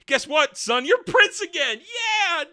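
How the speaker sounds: high-pitched, hammed-up royal retort; mock exclaiming king. King giving his son his rank back, high-pitched mock retort. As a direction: blurt it high like a cartoon father. Voices the king answering Durin.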